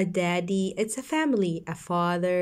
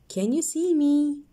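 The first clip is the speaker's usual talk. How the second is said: higher, quicker question; high bright question; 6 semitones higher, 3 dB louder than usual. Shown as a demonstration. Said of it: "So let's read".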